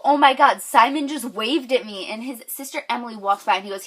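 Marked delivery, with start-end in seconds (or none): 0.0-2.1 fan excitement. Retold or imitated. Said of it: "and I go".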